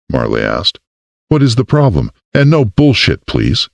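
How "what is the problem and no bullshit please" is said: a hint of a firm mayor; higher, pointed demand; lightly voiced woman. A narrator's reading.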